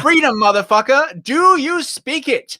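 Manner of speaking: hammed-up challenge, stretched at the end; mock challenge. Mock shout-out to Texans.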